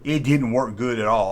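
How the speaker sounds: ordinary talk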